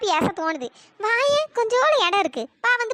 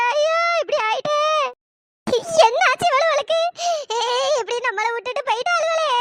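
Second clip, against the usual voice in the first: wailing lament; 3 semitones higher than usual. Acted. Villagers finding three friends lying in coffins, wailing lament. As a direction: draw out the line ends into quavering sobs. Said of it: "இப்படி ஒப்பாரி வச்சுட்டு கிடக்கியே".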